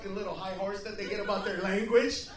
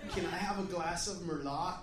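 California dude; 6 semitones lower, 8 dB quieter than usual. Retold or imitated. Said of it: "he's like".

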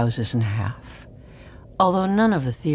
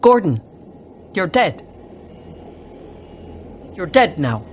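loud, punched retold yell; short, forceful shouts to the dying; 3 dB louder than usual. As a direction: call it out hard, each phrase dropping at the end. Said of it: "and they yelled".

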